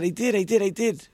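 lightly acted boy insisting to be believed; insistent protest. David answering the teacher about the lesson, insistent protest.

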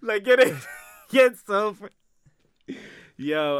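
high, loud laughing words; laughing through the words; breaking up laughing mid-sentence. Real, not acted.

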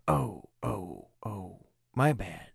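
normal talking voice

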